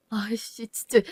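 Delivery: a frustrated swear barely voiced; muttered curse.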